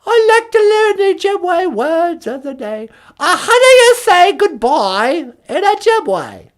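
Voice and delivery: put-on very high, loud posh British old lady; squeaky mock Queen Mum; Queen of England. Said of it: "Queen Elizabeth?".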